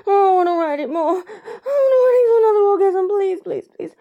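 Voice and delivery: high, loud, fast begging; urgent pleading. Acted. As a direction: plead urgently on the edge of crying. Urgent plea in intimate scene.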